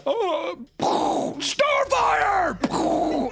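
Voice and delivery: very high, hammed-up shout; drawn-out imitation war cry; mock battle cry. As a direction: yell it like an action-film command. Imitating film's explosion battle cry.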